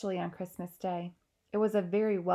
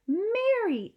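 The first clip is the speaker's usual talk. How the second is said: much higher worried call; the queen, concerned; 14 semitones higher, 6 dB louder than usual. Acted. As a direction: call her maid's name in concern. Queen notices the maid pale.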